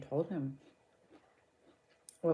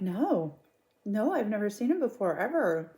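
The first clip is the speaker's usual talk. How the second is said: higher-pitched mock denial; insistent denial; 6 semitones higher than usual. Wife denying to police that she knows the man, mock insistence. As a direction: imitate an emphatic, swooping denial.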